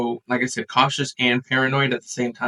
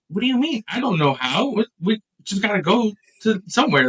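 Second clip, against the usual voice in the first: higher-pitched flustered protest; retold panic; 7 semitones higher than usual. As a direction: act out panicked objections. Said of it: "And I was panicking".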